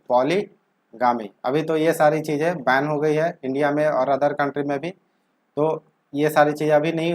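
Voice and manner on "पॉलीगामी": पॉलीगामी said slowly and deliberately; slow answer. Shown as a demonstration. Teacher answering own question for students, slow. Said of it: "तो उसे क्या बोलेंगे?".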